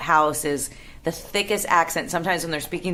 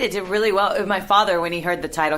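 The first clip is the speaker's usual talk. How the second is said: normal talking voice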